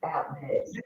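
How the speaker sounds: muffled and far from the mic; like talking through a wall. Real, not acted. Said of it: "You sound like you muffled".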